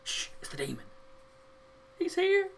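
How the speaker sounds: hushed, then a very high squeak; sleepy alien whisper. Retold or imitated. Voicing sleeping grunt.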